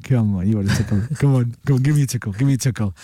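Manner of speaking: low, wheedling cat voice; coaxing cat. Acted. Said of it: "looks at you going".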